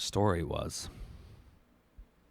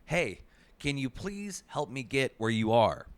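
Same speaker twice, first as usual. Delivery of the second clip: calling out; 7 semitones higher than usual. A narrator's reading. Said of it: "yelling to those up above you".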